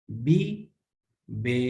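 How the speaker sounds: بی with a bright ee, then بے with an open eh. Shown as a demonstration. Teacher contrasting the two sounds for students. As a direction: contrast بی against بے. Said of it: "اب اس کی اچھی پروننس کیا ہے".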